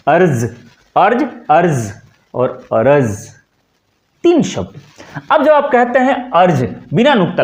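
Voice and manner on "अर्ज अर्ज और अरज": ordinary talk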